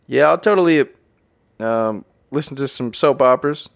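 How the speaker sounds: normal talking voice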